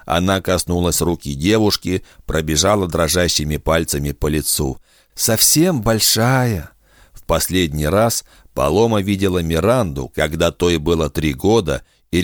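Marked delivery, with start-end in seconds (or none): 5.1-6.7 put-on high, slow delighted old voice; overjoyed grandmother. A narrator's reading. Grandmother greets her grown granddaughter, slow and high with joy. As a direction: stretch it out with tender joy.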